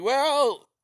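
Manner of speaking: high, whiny reasoning voice; mock Ukrainian peasant. Ukrainians weighing starvation against bullets, high and whiny. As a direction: whine it like a resigned peasant. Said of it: "Just like".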